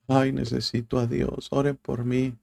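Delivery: a hint of tearful begging, imitated; mock crying plea. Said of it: "Y ahí vienen llorando". (súplica llorosa fingida, más aguda y suave)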